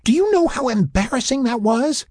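high-pitched, outraged scolding; complaining. A narrator's reading. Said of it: "she complained".

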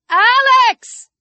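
high, stretched shout of a name; calling out. A narrator's reading. Chris closes in, calling Alex, shouting. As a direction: yell after someone escaping.

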